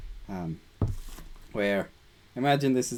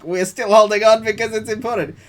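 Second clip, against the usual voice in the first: much higher and louder, whiny put-on voice; clinging inner voice; 11 semitones higher, 11 dB louder than usual. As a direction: play the stubborn part that won't let go. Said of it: "things that are like".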